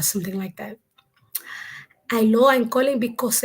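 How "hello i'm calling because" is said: put-on Dominican Spanish accent. Survey caller starting a phone pitch, Dominican accent. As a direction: greet them in a Dominican accent. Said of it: "I would do a Dominican accent".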